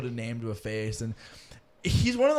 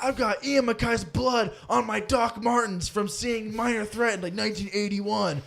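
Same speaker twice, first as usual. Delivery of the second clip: put-on a half-hearted go at Mitchell's accent, pitched up and drawn out; 7 semitones higher than usual. Host imitating Mitchell bragging about a [name] show, in Mitchell's accent, higher and slow. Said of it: "I can't do his accent".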